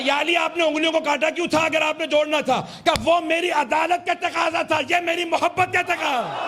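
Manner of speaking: very high, sustained climactic cry; full-force preaching. Real, not acted. Preacher giving the crowd the master's answer, full-force preaching. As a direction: hold the pitch at the top and cry it out.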